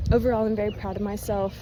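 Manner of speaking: ordinary talk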